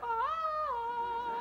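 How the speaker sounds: high shriek of shock, half laugh. Acted. Wife waking up finding new breasts, high shocked shriek.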